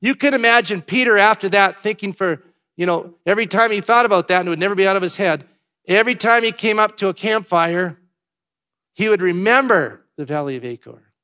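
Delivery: loud and high, driving the story of Peter; preaching at full force. Real, not acted. Preacher to congregation about Peter, preached loud and high. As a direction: hammer it home like a revival preacher.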